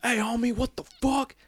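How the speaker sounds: high mock tough-guy yell.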